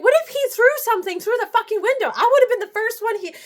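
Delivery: high, rushed retold scolding; retold anger. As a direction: replay telling off a partner in a rush. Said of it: "I just got so mad at her".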